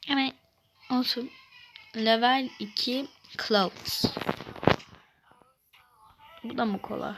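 ordinary delivery

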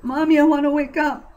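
put-on a small girl begging her mother, scared; young daughter pleading with her mommy, tearful child voice; frightened little girl. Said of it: "Maggie sniffled, crying".

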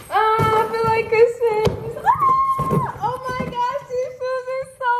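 real thrill opening new shoes; squealing with joy. Real, not acted.

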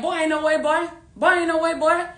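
lightly voiced incredulous buddy; T J's disbelief. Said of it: "how T J be saying".

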